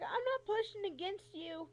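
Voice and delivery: high-pitched insistent denial; exasperated protest. Retold or imitated. Patient insisting to doctor she isn't resisting, exasperated. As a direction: act out protesting your innocence. Repeats protest without the swearing.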